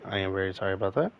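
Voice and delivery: ordinary talk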